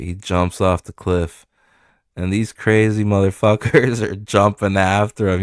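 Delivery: ordinary talk, nothing marked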